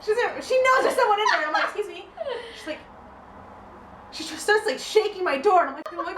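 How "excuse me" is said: small, high, soft protest; timid objection. Retold or imitated. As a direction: pipe up quietly at an intruder. Acting first objection to intruder.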